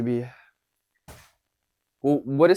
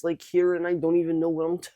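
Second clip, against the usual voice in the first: tight, held-up, higher voice; stifled voice; 3 semitones higher than usual. Shown as a demonstration. Coach showing a rigid voice without looseness, stifled and higher. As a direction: choke it up as if unsure what to say. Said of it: "not so stifled up in my voice".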